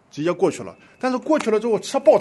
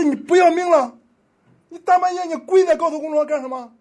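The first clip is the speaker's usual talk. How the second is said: high, loud angry scolding; furious driver shouting from his car; 9 semitones higher, 8 dB louder than usual. Retold or imitated. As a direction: yell at the fool in the road. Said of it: "人家还很气愤".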